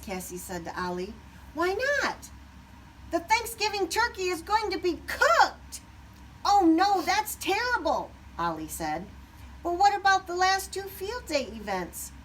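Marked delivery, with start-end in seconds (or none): 1.5-12.1 alarmed turkeys. A narrator's reading.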